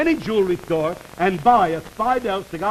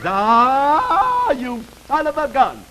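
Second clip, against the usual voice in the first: mock woman's exclamation; 3 semitones higher, much slower than usual. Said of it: "she says".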